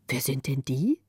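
low, wondering question; puzzled old woman. Acted. Frau Rönnchen wondering low at all the strange children. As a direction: ask it low, taken aback. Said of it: "fragte sie".

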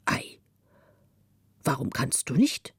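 a hint of a low old man wondering; lightly voiced old Müffert; old man's voice. A narrator's reading. Müffert replies to the boy. (leicht gespielter alter Mann, verwundert)

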